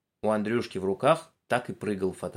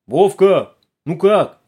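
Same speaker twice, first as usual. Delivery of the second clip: loud call down a pipe; 8 semitones higher, 13 dB louder, much slower than usual. Acted. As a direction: shout into the tube. Calling down the periscope tube.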